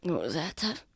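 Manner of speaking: soft murmured question; quiet in character. Acted. Injured Lucian questions Tav's remark, quiet murmur.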